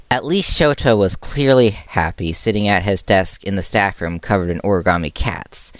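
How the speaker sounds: plain reading voice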